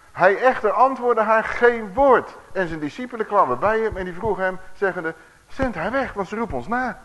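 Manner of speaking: loud, high, emphatic Bible reading; scripture reading. A narrator's reading. Preacher reading scripture to the congregation, loud and emphatic. Reads the Bible passage aloud.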